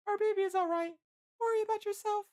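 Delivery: very high falsetto woman's voice; reassuring Licia. A narrator's reading. Licia tells wounded Bill not to worry, reassuring falsetto.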